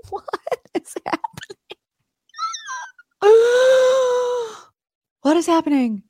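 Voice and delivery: high breathy gasping disbelief; stunned gasp. Retold or imitated. Morgan recognised by Carl, high gasping shock.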